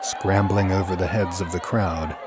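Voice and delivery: usual speaking voice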